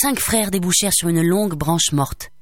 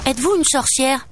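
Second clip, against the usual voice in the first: put-on higher child's voice, blurting it out; abrupt, daring question; 6 semitones higher than usual. A narrator's reading. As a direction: dare to ask it abruptly. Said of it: "osa Jacques brusquement". (question brusque, voix plus aiguë)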